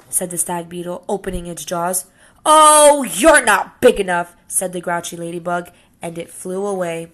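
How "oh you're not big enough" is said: loud, high, dismissive sneer; scornful brush-off. Acted. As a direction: wave him off to save face. Grouchy ladybug backs off.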